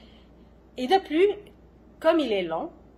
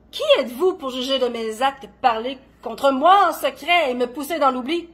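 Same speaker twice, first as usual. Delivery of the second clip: louder, quick accusing outburst; indignant challenge; 3 semitones higher, 9 dB louder than usual. Acted. Woman called mad challenges those who judge her, indignant. Poet confronts accusers in poem.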